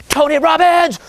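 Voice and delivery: high, roaring hype-man yell; Tony Robbins. Retold or imitated. Mocking a self-development guru for the audience, high roaring shout. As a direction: imitate an over-pumped motivational speaker bellowing. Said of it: "like this, right?".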